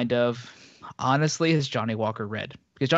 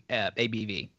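normal talking voice

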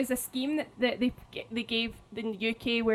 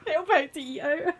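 laughing; 5 semitones higher than usual. Real, not acted. Laughing at the slogan mix-up.

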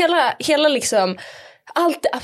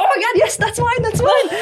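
squealing high excited relief; overjoyed; 12 semitones higher, 4 dB louder than usual. Retold or imitated. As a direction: shriek with relief. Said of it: "Och jag bara". (glad lättnad, hög röst)

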